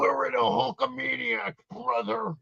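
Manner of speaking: mock Hulkamania shout; mock Hulk Hogan. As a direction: sell it like an eighties promo. Hulk Hogan style taunt.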